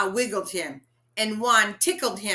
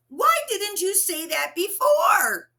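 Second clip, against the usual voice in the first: exasperated shout; 11 semitones higher, 6 dB louder than usual. Acted. Shocked carpenter shouts.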